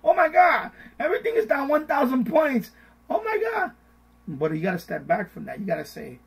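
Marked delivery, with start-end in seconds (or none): 0.0-3.7 panicky, much higher and louder; mock panic. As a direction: squeal like a scared investor watching the crash. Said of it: "you're seeing the sky fall".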